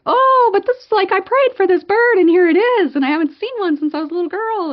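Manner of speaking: high, loud excited gushing; freaking out. Retold or imitated. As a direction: gush breathlessly at the top of your voice, speeding up. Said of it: "freaking out over this bird".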